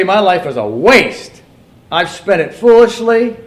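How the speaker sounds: high, loud, declaimed story; preacher's reading. Acted. Preacher reads of a politician's ruin, loudly declaiming. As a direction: ring each phrase out to the congregation.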